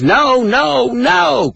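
very high, drawn-out anguished cries; acted scream. Reader voicing Mom held back at resuscitation, acted scream. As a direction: cry out in horror, voice way up high. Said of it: "as she screams".

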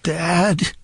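feeble voice. Retold or imitated. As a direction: sound weak and small, like a son in trouble. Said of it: "a feeble voice on the other end".

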